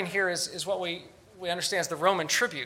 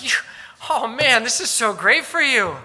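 louder, higher mock delight; sarcastic; 5 semitones higher, 9 dB louder than usual. Paul mocking the self-satisfied Corinthians, sarcastic gushing. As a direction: gush with fake enthusiasm. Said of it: "Do you hear the sarcasm?".

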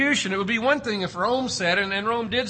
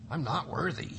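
soft, lower, humble "I'm not worthy"; humble centurion; 5 semitones lower, 8 dB quieter than usual. Retold or imitated. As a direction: say it quietly, bowed low.

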